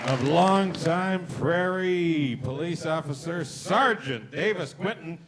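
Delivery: booming game-show announcer; announcer intro. Acted. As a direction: sell each name to the crowd. Host introduces cast members.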